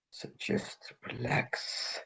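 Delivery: soft whispered relax; whispering. Acted. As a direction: breathe it out gently.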